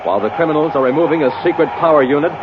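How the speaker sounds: ordinary delivery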